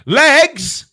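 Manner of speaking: high, loud bingo call of legs. Pretend. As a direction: belt it like a bingo caller. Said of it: "I could be a bingo caller".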